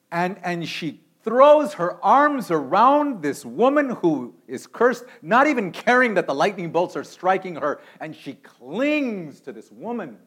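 loud, high, driving sermon climb; preaching at full force. Acted. Preacher thunders the story of the storm.

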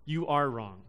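"are" leaned on, falling; stress on are. Shown as a demonstration. Preacher setting shame against guilt, leaning on the verb. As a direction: press "are" to make it about the person. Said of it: "Shame says".

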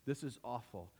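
low, soft, defeated sigh of a voice; weary despair. Retold or imitated. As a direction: sound worn out and ready to quit. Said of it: "Most of us would be like".

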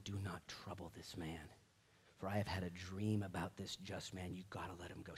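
low, very soft urging; hushed, low plea aside; confidential warning. Acted. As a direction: warn him privately in a hush. Wife takes Pilate aside, warns him.

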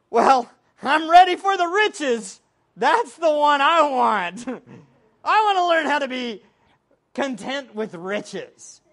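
loud, keen wish for riches; eager mock quote. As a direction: act out everyone eagerly wanting riches. Acts out everybody wanting riches.

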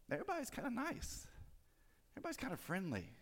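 high, soft singsong; mock-sweet. Preacher describing friendly shoppers in line, mock-sweet. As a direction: lilt it lightly and quietly, up high.

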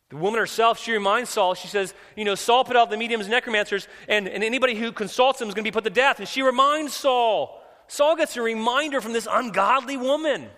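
high, loud, driving sermon delivery; full-force preaching. Real, not acted. Preacher retelling the woman's warning to Saul, full-force preaching. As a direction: preach it loud and high, building to the last word.

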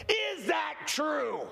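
very slow, skeptical question; drawn-out doubt. Retold or imitated. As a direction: stretch it out in disbelief. Preacher doubts a miracle claim.

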